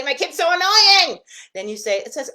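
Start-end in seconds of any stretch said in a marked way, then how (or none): 0.0-1.2 high, loud, slow, fed-up whine; a worn-out parent venting; hammed-up exasperated parent; exasperated complaint. Parent complaining about her kid.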